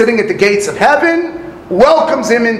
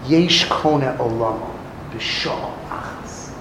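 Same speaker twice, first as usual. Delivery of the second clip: much lower, soft, fading lament of yesh kone olamo b'shah achas; mournful lament; 10 semitones lower, 9 dB quieter than usual. Retold or imitated. Rebbe mourning a wasted life, mournful lament. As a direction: say it as a tragedy, almost breathless. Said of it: "Rebbe wasn't complimenting him".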